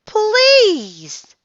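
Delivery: please, shouted very high and loud. A narrator's reading. Girl yells please at the octopus, very high and loud. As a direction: cry out please with all she has. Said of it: "Annie shouted at the octopus".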